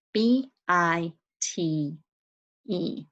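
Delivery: letters of "bite" spaced out slowly; slow spelling. Shown as a demonstration. Teacher slowly spells the word for young students. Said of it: "E at the end".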